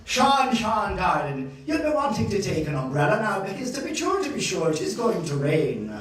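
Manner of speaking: loud Irish grandmother imitation; thick Irish accent. Irish grandmother warns grandchild of rain, thick Irish accent. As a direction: play an insistent Irish grandmother. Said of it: "with her thick Irish accent".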